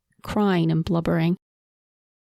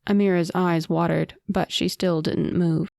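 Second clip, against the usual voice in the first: ordinary delivery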